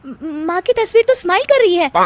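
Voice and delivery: high, stammering astonishment; startled. Acted. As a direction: blurt it out in shock. Bride startled by smiling photo. (चौंकी हुई, ऊँची आवाज़ में)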